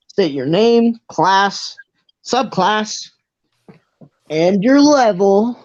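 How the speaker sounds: high, deliberate official voice; ticketmaster. Acted. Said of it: "he walks over to Grail".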